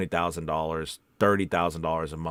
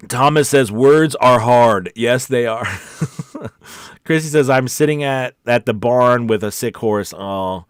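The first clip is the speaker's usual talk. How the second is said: ordinary talk, nothing marked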